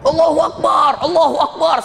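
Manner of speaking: imitating an awed congregation, high-pitched cry; high, mock-jubilant takbir; elated takbir. The congregation cries takbir in awe at a convert's story, elated. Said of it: "saking gembiranya".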